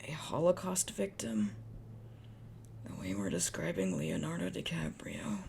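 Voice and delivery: incredulous whisper. Real, not acted. Reader whispering in disbelief at the story she reads. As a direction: breathe it out in disbelief. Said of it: "whispered disbelief aside".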